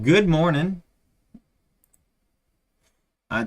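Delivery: ordinary talk, nothing marked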